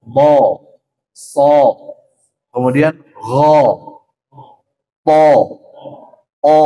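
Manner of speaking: "ho, so, bo" full and heavy, slow; thick letter drill. Shown as a demonstration. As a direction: round but not pursed: "bo" not "bu". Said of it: "Antara o dan a". ("bo" tebal, jangan monyong)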